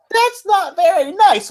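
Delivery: put-on high, slow little-girl voice; mock little girl. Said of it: "and this little girl".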